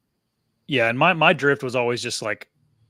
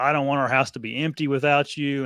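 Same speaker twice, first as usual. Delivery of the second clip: usual speaking voice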